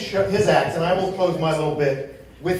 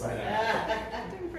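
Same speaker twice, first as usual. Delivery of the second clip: usual speaking voice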